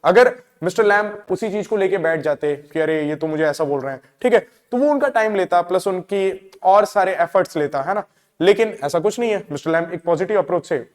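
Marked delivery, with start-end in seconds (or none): none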